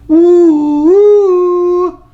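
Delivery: high, loud excited woo; woo holler. Real, not acted. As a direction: let out a high celebratory whoop. Excited hollering at end of video.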